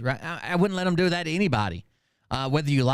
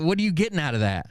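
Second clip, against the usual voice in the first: ordinary talk, nothing marked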